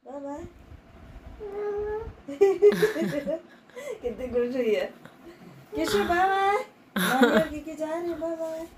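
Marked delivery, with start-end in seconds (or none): 1.4-3.4 loud, high burst of laughter; real, high-pitched laughing; delighted laugh. Real, not acted. Amused at children saying goodbye.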